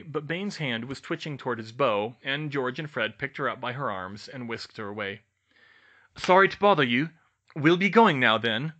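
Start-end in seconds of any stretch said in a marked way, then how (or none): 6.2-8.7 raised, loud parting apology; calling behind. A narrator's reading. Twins retreat from Bane with Hermione, calling back. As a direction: call back over your shoulder. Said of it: "they called behind them".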